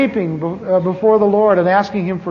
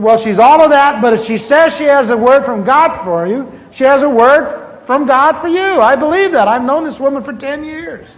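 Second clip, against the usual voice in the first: higher, louder, emphatic friend insisting; insistent pastor; 6 semitones higher, 5 dB louder than usual. Retold or imitated. Pastor Ray urging John to meet the woman, emphatic and loud. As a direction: press the point hard, mock-firm.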